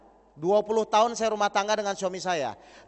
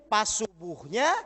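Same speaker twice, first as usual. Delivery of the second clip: normal talking voice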